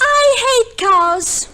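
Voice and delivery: indignant wife refusing farm life; emphatic distaste. Acted. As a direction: drag out the loathing.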